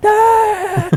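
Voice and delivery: high yelping ah; dog yelp. Retold or imitated. Voicing the stung dog yelping as owner grabs it, high yelp.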